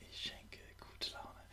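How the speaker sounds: soft whispered repeat; whispered echo. Shown as a demonstration. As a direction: whisper it back. Repeat-after-me affirmations.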